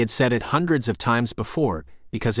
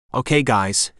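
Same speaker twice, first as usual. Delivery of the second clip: plain reading voice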